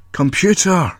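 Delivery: insist, call out the name hard. A narrator's reading.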